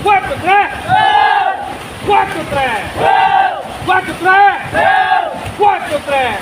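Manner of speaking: loud, high shouted call; shouted count. Shown as a demonstration. Drill instructor calling a loud count in the water. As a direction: yell it out for the group to hear. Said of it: "saka lang kayo sisigaw".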